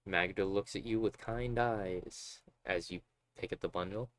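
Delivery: normal talking voice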